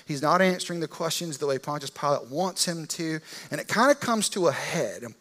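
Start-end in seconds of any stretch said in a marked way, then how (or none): none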